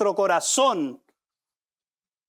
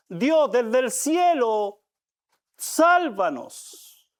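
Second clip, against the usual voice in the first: drawn out, each word stretched; slow pleading prayer; 2 semitones higher, much slower than usual. Shown as a demonstration. Preacher voicing the crowd's plea, slow and pleading. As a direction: plead slowly to heaven. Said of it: "es como decir".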